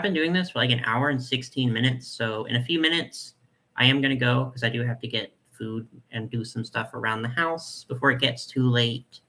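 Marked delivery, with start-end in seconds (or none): none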